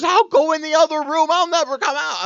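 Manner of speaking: high, loud hammed-up tantrum; imitated hysterical retreat; mock dramatic outburst. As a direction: wail it like an overwrought wife. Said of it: "And she goes".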